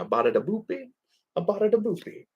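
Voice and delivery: playfully imitating a cartoon's fake Italian; mock Italian gibberish. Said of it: "I just remember that one where he's like".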